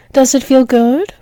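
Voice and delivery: normal talking voice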